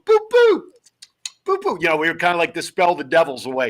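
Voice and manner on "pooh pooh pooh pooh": high-pitched "boo, boo" to ward off jinxes; quick ritual "boo, boo", pitched way up; superstitious warding-off. Shown as a demonstration. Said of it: "we would go".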